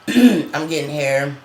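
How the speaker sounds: usual speaking voice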